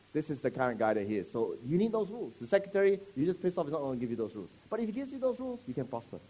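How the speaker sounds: ordinary delivery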